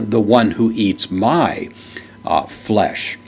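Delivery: slow, with my leaned on firmly; the one who eats my, weight on my; stress on my. Shown as a demonstration. Teacher shows the emphasis Greek puts on my, stressing my. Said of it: "you'd put special emphasis on it".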